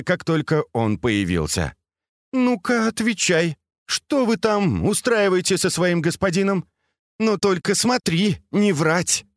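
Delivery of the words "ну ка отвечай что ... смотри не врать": high-pitched, demanding mistress; stern interrogation. A narrator's reading. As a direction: press the servant for the truth. Angry mistress interrogates trembling servant.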